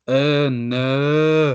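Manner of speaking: "Uh, no" with long loud er vowels; exaggerated Hull vowel. Shown as a demonstration. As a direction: stretch "Uh, no" into Hull "er, ner". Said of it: "everybody always picks it up on me when I'm like".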